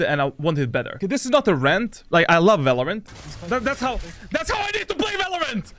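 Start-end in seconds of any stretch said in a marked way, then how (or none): 3.5-5.7 high-pitched screaming rage; raging gamer. Pretend. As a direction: scream at the game in fury. Mock rage clip after I love Valorant.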